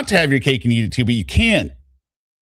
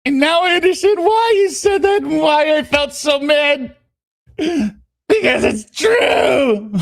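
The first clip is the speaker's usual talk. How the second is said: high-pitched woman's reading voice; 10 semitones higher than usual. Acted. Texting her lover about their fight, high put-on voice. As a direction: read her messages in a raised, dramatized pitch.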